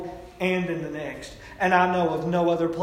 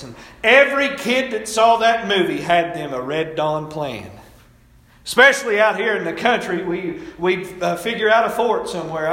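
loud, driving preacher cadence; preaching at full force; 3 semitones higher, 7 dB louder than usual. Acted. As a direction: preach it hard to the congregation.

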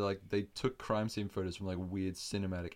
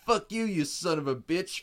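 raised, loud hammed-up cursing; mock rude cop; 8 semitones higher, 7 dB louder than usual. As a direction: hurl it as an over-the-top insult. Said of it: "instead of just saying".

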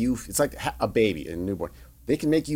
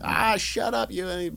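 retold scream; 9 semitones higher, slower than usual. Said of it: "start screaming at each other".

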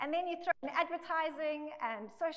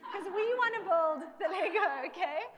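laughing; 4 semitones higher, 5 dB louder than usual. Real, not acted. Laughing at own Lego joke.

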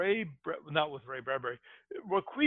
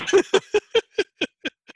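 high burst of laughter; loud laugh; 8 dB louder than usual. Real, not acted. Big laugh at own joke.

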